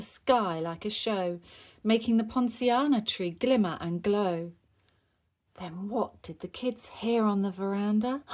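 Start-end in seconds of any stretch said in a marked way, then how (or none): none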